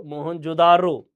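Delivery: drawn-out मोहनजोदारो, "daaro"; Mohenjo-daro variant. Shown as a demonstration. Teacher repeats the last variant slowly and loudly to the class. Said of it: "अलग-अलग उच्चारण होता है".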